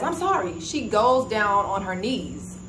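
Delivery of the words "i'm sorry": imitated apology. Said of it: "That's what she says".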